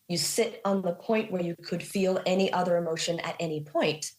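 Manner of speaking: ordinary talk, nothing marked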